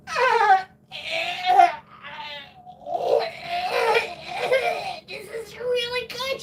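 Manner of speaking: very high, loud mock-crying wail; hammed-up sobbing compliment; tearful fake praise. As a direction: wail through pretending it's delicious. Pretends to like food he dreads.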